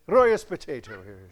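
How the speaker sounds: playful Irish brogue, punched at the start; mock Irish accent. Preacher showing off the accent for laughs, Irish accent. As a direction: toss off a joke Irish accent. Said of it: "like to do the accent".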